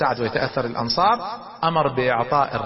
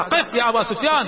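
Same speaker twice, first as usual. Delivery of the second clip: calling out; 8 semitones higher than usual. Retold or imitated. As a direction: call him to stop from afar. Abbas calls out to Abu Sufyan.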